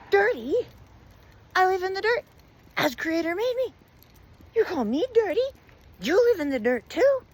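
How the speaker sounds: very high, loud outraged squawk; offended rat. A narrator's reading. Rat called dirty by the old woman, outraged. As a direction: throw the insult back, loud and high.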